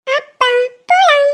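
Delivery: put-on high, bright child calling her father; little girl voice. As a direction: sound like a small girl eager to leave. Actress voicing a small daughter.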